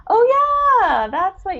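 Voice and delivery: high sudden oh yeah, as if it just dawned; mock realization. Writer to listeners, mimicking a sudden realization, pitched high. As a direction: play the writer remembering the basics with a jolt. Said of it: "we're like".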